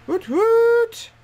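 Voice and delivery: high, loud cheering whoop; celebratory whoop. Real, not acted. Player celebrating going up a rank, celebratory whoop.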